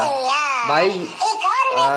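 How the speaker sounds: very high, laughing squeal through the words. Real, not acted. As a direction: squeak it out, cracking up. Amused by yet another message.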